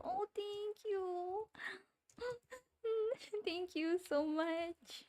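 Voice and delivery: very high, slow, overcome with gratitude; touched thanks. Real, not acted. Streamer overwhelmed by a fan's kind words, very high thanks. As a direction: melt into a grateful squeal. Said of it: "moved thanks after laughter".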